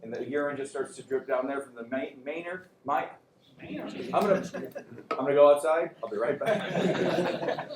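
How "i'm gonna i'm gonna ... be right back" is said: real laughter breaking into the words; flustered and losing it after a tongue slip; laughing at own slip. Real, not acted. Cracks up after fumbling a word.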